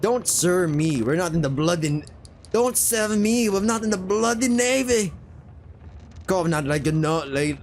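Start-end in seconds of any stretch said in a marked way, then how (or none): 2.5-5.1 higher-pitched, put-out sailor voice; irritated sailor. Acted. Sailor rebuking new deckhand for saying sir, high irritated voice.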